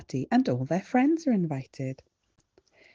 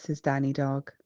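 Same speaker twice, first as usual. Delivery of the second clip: plain reading voice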